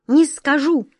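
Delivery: high, loud pouting no; stubborn refusal. A narrator's reading. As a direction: refuse flatly, sulking. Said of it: "заупрямился Вихрик".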